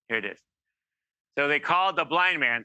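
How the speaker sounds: normal talking voice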